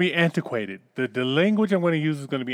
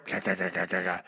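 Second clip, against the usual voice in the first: knock imitation; 9 semitones lower, 9 dB quieter than usual. Knocking sound imitated in joke.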